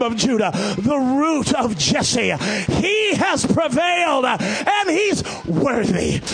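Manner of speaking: loud high shouted preaching, slowing on the peak; climactic preaching. Real, not acted. Pastor proclaiming to the church, roaring.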